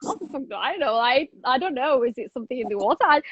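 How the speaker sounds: normal talking voice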